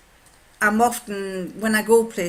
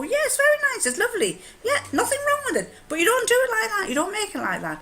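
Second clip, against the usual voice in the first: high-pitched, quick imitation of a fussy husband; mock grudging approval; faster than usual. Husband judges wife's rice, imitated high fussy approval. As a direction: praise lightly, then nag, all pitched up.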